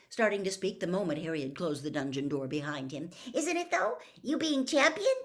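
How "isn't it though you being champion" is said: put-on much higher, louder breathless boy; excited gush. Starstruck boy gushing at Harry, excited and high.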